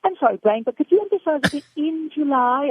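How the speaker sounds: chirpy high Pinky asking Brain; high silly British sidekick. Acted. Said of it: "And Pinky says".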